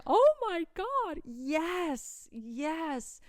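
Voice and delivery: high, loud acted-out squeal of joy; overjoyed. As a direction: relive the thrill, drawn out at first. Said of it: "I was jumping up and down".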